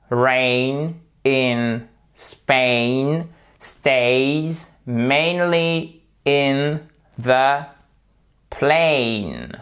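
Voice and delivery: "rain in Spain stays mainly in the plain" stretched word by word, every AIN long; drawn out so learners hear each vowel; very slow drill. Shown as a demonstration. Teacher to students, slow drill. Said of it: "On va s'entraîner sur le A I N".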